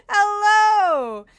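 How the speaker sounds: jump-scared, high and loud laughing; startled shriek dissolving into laughter; scared horror game streamer. Real, not acted. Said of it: "You scared the daylights out of me".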